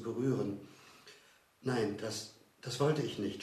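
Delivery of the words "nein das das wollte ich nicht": ordinary delivery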